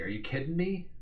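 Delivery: mock disbelief. Mock disbelief at found data.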